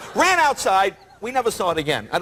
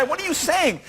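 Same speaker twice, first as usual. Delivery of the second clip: higher, incredulous retold challenge; exasperated protest; 6 semitones higher than usual. Guest confronting the translator, exasperated.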